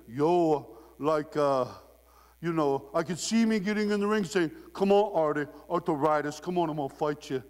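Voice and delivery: put-on Italian accent. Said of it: "he's doing that Italian accent".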